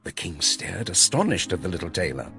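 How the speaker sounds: usual speaking voice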